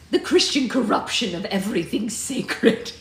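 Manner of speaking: scornful, grand pronouncement; haughty disdain. Acted. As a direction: sneer it like a witchy matriarch. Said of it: "I can't even say that with a straight face".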